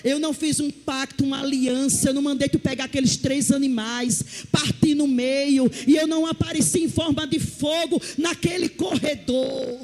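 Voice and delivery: booming sermon delivery; preaching as God. Acted. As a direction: proclaim God's words with force.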